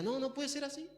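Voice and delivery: high, soft mock-whiny voice; hammed-up petulant disbelief, pitched high; vain Lucifer at the mirror. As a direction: protest to your own reflection in a small high voice. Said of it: "se miraba en el espejo y decía".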